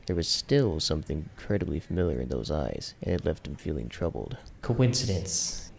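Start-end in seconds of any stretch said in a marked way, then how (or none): 4.6-5.7 a slow, higher reminder to himself; slow inner voice. A narrator's reading. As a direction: stretch the word out slowly. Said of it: "his inner voice reminded him".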